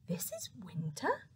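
breathless whispered amazement; a whispered astonished gasp. Acted. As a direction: gasp it in disbelief. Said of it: "gasped the robin".

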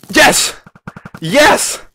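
loud, high yells of victory; triumphant shout. Real, not acted. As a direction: cheer as you land it. Lands the jump.

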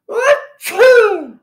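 loud, high acted sneeze; huge sneeze. A narrator's reading. Startled girl lets rip her biggest sneeze. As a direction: explode into a big cartoon sneeze. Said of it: "let rip the biggest sneeze".

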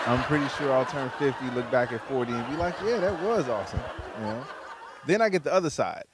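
normal talking voice

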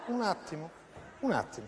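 low, soft repeat; soft aside. Acted. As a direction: drop it to a murmur. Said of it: "Un attimo qua".

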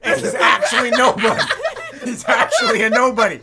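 very loud, high, laughing disbelief; incredulous yelling. Real, not acted. Player telling friends the celebrity is unknown, yelling in laughing disbelief. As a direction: shout it like an outrageous discovery.

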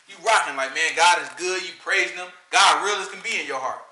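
ordinary talk, nothing marked

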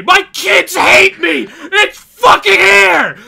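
half crying, half shouting with joy; joyful sobbing yells. Real, not acted. Gamer crying out as the remaster is finally announced. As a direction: yell through happy tears. Overjoyed ranting reveal reaction.

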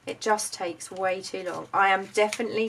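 ordinary delivery

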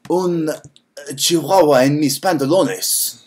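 slow, hammed-up Italian accent. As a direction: ham up an Italian accent in Spanish. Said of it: "that was like an Italian accent".

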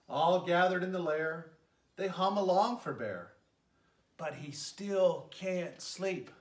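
gentle, lilting read-aloud voice; storybook narration. A narrator's reading. Mayor reading a bedtime story to children, lilting narration. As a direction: tell it like a bedtime story for little ones. Reads picture book to children.

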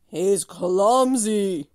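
loud, slow cow voice; slow, loud mooing cow voice. A narrator's reading. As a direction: draw it out like a lowing cow. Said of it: "mooed the cow".

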